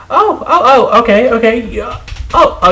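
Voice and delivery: sarcastic high "oh, okay"; mock realization. Real, not acted. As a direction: feign sudden understanding, pitched high.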